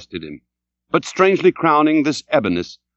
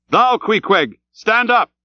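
high, raised command; calling an order; 10 semitones higher, slower than usual. A narrator's reading. Starbuck orders Queequeg up, calling out. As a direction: call the harpooneer to his feet. Mate orders his harpooneer.

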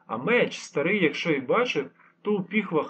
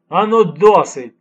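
loud, slow commanding bark; barked order; 3 semitones higher, 15 dB louder, slower than usual. A narrator's reading. Lieutenant halting the fight, barked command. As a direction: snap them to stop at once. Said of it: "гаркнув лейтенант".

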